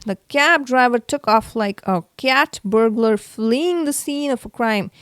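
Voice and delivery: plain reading voice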